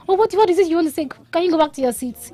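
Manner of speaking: fast, high, loud scolding of a pupil; impatient teacher shout. Retold or imitated. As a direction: snap at the kid to sit back down. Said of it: "she wants to just shout and say".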